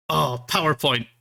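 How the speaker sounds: ordinary talk, nothing marked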